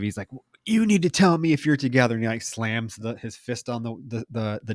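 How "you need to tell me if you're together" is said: fast, raised-pitch mock confrontation; imitated aggressive demand. Axel to his wife about Tom, mock aggressive. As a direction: press the demand quickly, imitating a jealous husband. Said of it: "he gets real aggressive".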